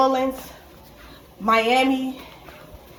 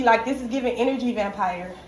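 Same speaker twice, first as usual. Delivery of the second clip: ordinary talk, nothing marked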